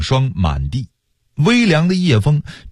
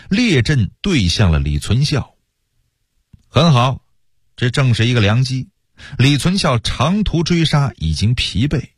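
plain reading voice

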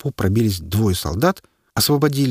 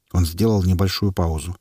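ordinary delivery, nothing marked

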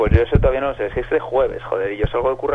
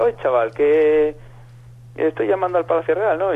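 higher, lilting Basque caller voice; imitated Basque accent; 5 semitones higher, slower than usual. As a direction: mimic a Basque woman on the phone. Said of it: "acento vasco y parecía fumada". (imitando con voz más aguda a una vasca que llama)

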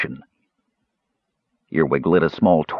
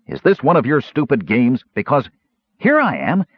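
annoyed; 5 semitones higher than usual. A narrator's reading. Annoyed warrior.

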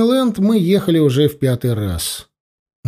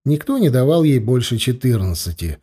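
ordinary delivery, nothing marked

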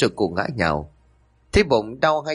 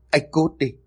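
higher-pitched angry rebuke; angry cursing; 5 semitones higher, faster than usual. A narrator's reading. Grieving wife pushes husband away, cursing him angrily. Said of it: "hất hắn ra rồi chửi".

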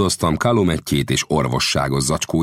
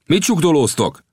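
higher, louder gruff demand; rude snap; 11 semitones higher, 3 dB louder than usual. A narrator's reading. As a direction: bark it at them roughly. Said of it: "förmet rá a törzsfőnök most már gorombán".

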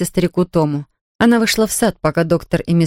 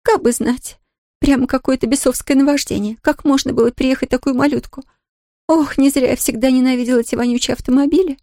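lightly higher upset maid voice; a worried servant woman; 6 semitones higher than usual. A narrator's reading. Nancy voiced by reader.

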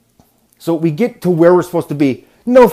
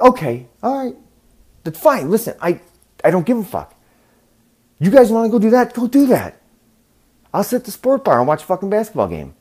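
fed-up sarcasm; 7 semitones higher than usual. Retold or imitated. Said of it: "everything I said is wrong".

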